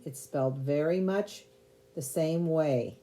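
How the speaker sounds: ordinary talk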